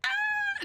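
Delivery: stifled high squeal; whisper yelling. Real, not acted. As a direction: scream under breath for the neighbors. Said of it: "whisper screaming".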